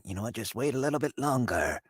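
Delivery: imagined Klaus Schwab. Retold or imitated. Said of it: "That's how I imagine Klaus Schwab sounds".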